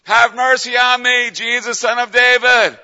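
high, loud, slow drawn-out yell; crying out. Acted. Blind beggar pleading with Jesus as he passes, crying out. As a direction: call out at the top of your lungs. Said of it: "yelling at the top of his lungs".

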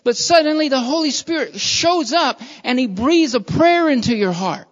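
loud, high, driving preacher's cry; full-force preaching. Acted. Preacher urging the church to take up love, loud and soaring. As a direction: preach it out hard to the congregation.

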